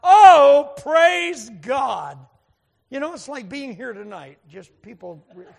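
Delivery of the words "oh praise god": sudden loud, high whoop of praise; yelled praise. Retold or imitated. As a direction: holler it out from the crowd, drawn out. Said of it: "just yelled out".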